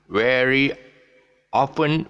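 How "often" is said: "often" as OFF-ten, hard T; T sounded. Shown as a demonstration. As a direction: wrongly put a T in "often". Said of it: "we make the mistake for this word".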